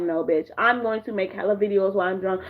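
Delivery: low, sassy dismissal; low sassy reply. Pretend. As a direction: say it low with sass. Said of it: "Drunk Twist is like".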